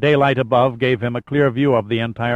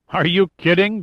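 higher, eager blurt; blurted enthusiasm; 7 semitones higher than usual. A narrator's reading. Redfern answers Pitt's thanks for coming, blurting with enthusiasm. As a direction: blurt it out with wide-eyed enthusiasm. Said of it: "blurted Redfern, eyes wide with enthusiasm".

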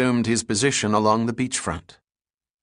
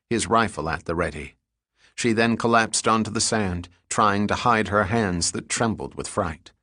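plain reading voice

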